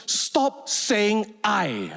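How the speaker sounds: very slow stop saying I; hammed-up stern boss; slow stern command. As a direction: drag out the order. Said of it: "he suddenly gets angry".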